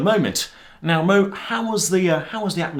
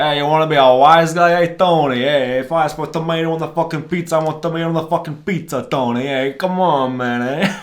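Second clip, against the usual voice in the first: loud, fast, hammed-up New York wise guy; over-the-top New York pizza-order tirade; mock New York accent; 8 dB louder than usual. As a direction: rant like a cartoon New York tough guy. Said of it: "that's the New York accent".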